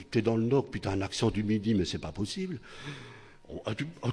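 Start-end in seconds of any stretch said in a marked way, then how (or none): none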